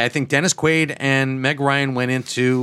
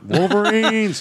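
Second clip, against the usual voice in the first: movie battle cry; 8 semitones higher, slower than usual. Retold or imitated. Quoting Red Dawn battle cry.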